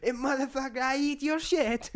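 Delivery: high comic foreigner voice; mock-angry accented impression; Borat. Continues the Borat movie quote.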